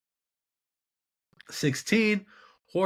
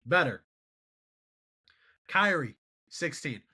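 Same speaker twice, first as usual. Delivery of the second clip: normal talking voice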